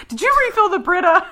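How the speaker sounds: high, quick goofy shout-out; imitating Jason. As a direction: toss it out fast in a high dopey voice. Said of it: "his good-natured goofiness and dopiness".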